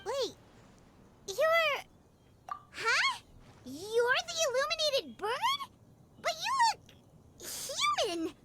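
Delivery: high, stammering double take; startled disbelief. Acted. Paimon realizing the Adeptus is the bird, stunned. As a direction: Paimon can't believe the bird turned human.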